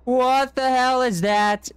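high, loud shocked cry; startled shout. Real, not acted. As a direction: react to a sudden scare. Sees the monster suddenly.

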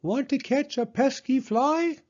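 very high crowing rooster. Acted. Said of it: "crowed the rooster".

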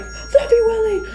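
very high, contained yell; high-pitched pretend shriek; mock scream. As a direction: imitate screaming a name without full volume. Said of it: "They're screaming each other's pet wigs' names".

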